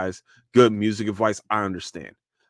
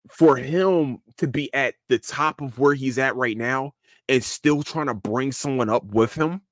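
ordinary delivery

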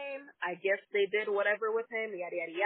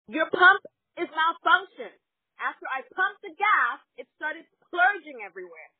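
irritated retort; 6 semitones higher, 6 dB louder than usual. Retold or imitated. Retold argument with dismissive manager.

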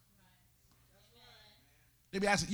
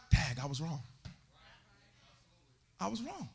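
quiet realization; 4 semitones lower, 6 dB quieter than usual. Retold or imitated. Imitated dawning realization.

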